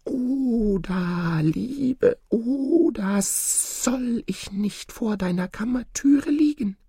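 high, very drawn-out wheedling snake voice; pleading snake. A narrator's reading. Snake begs to lie at Oda's chamber door, pleading. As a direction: call high and slow, stretching the name out. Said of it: "so rief die Schlange wieder".

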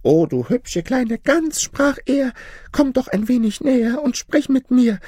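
goose's high imitation of a kindly lion luring her closer; friendly young lion. Goose quoting a young lion inviting her nearer, friendly. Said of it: "Er sah mich freundlich und gütig an".

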